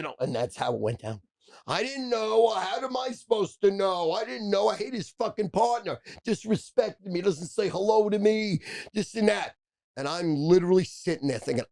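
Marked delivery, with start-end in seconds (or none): 1.7-9.5 high-pitched retold argument; pushed-up, defensive quarrel voice; heated protesting. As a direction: act out an indignant back-and-forth, voice pitched way up. Acted-out argument during the confrontation.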